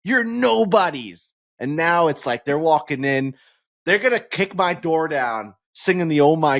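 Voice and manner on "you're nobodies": normal talking voice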